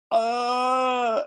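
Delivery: high, startled yelp; a frightened gasp at being caught; scared stammer. Retold or imitated. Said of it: "the dude's like".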